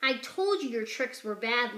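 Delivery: ordinary delivery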